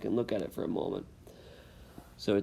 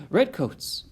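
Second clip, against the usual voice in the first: louder, higher frightened exclamation; alarmed cry; 8 semitones higher, 7 dB louder than usual. Acted. Dicey seeing soldiers riding up behind her, alarmed cry. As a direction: blurt it out in sudden alarm.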